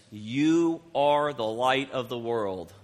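"You are the light of the world" read very slowly, stress on "You"; scripture read slowly with weight on "You"; slow solemn reading. Shown as a demonstration. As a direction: draw out each word, landing on "You". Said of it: "This is not somebody talking to Jesus".

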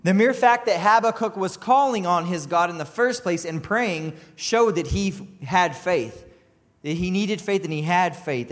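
ordinary talk

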